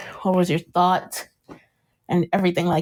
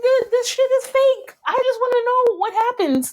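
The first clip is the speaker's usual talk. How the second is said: much higher, worked-up quote; excited retold outburst; 13 semitones higher than usual. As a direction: blurt it out high, imitating giddy impatience. Animatedly acting out an impatient quote.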